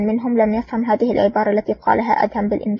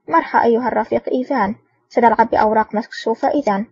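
plain reading voice

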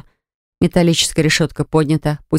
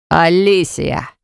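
slow, drawn-out calling of a name; much slower than usual. Acted. Kirsten calls her name.

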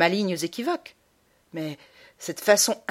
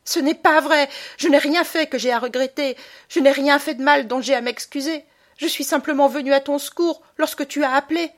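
loud, higher protest; angry boy; 8 semitones higher, 7 dB louder than usual. Acted. Boy accusing his mother, indignant shout. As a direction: fling it back at her.

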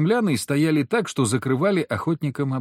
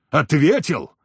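higher, rising outrage on the repeat; indignant louder echo; 4 semitones higher, 4 dB louder, slower than usual. A narrator's reading. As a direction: throw the word back angrily. Furious hunter repeats, louder. (возмущённо, громче переспросить)

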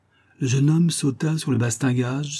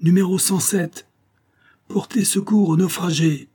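high, sailor's announcement; calling out; 9 semitones higher than usual. A narrator's reading. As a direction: call out the signal for all to hear. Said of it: "cria le matelot". (annonce criée, voix haute)

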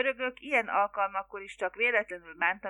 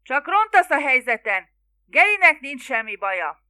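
loud, raised shouting; exasperated bellow; 5 semitones higher, 12 dB louder than usual. A narrator's reading. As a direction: keep roaring at your mother. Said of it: "bömbölte Larry". (ingerülten bömbölve)

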